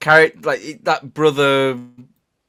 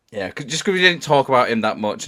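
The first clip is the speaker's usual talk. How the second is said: ordinary delivery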